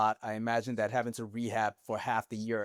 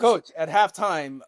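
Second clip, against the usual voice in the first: drawn-out call; 6 semitones higher, 11 dB louder, much slower than usual. Acted. Calls out to coach, drawn out.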